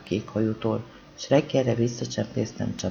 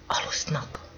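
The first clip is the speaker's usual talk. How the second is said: whispered; 3 semitones higher, 6 dB quieter, slower than usual. Acted. Bede whispering to Márta so the sleepers don't wake. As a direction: whisper it, unvoiced and hushed. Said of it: "És piszegtek".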